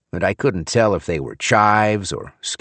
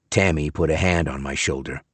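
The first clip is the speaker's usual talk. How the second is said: usual speaking voice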